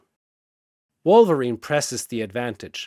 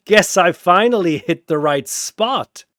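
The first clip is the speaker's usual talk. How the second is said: gloating; 5 semitones higher, 8 dB louder than usual. Acted. Wolverine gloating.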